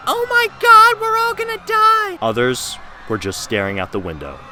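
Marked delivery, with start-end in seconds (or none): 0.1-2.2 high, loud mock panic; panicked girls. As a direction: shriek it like scared schoolgirls. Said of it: "The girls were bawling their eyes out like".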